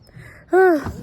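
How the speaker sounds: a loud, high, contented sigh; a loud sigh at the beautiful view; big happy sigh. Real, not acted. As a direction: let out a huge sigh of bliss. Moved by the scenery.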